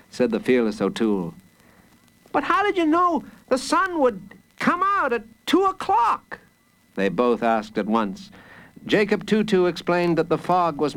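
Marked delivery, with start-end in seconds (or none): 2.3-6.4 high-pitched, eager character voice; high, wondering storybook question; amazed questioners. A narrator's reading. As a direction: ask it in a high voice, full of wonder. Two characters ask together.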